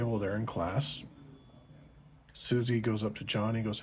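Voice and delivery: ordinary talk, nothing marked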